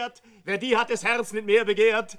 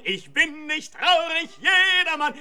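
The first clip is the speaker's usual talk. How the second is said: higher and louder, building insistence; rising protest; 6 semitones higher, 4 dB louder than usual. Acted. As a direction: push the denial up with force.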